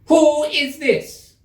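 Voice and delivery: high, slow, loud, haughty queen; severe queen. Acted. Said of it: "the Queen said severely".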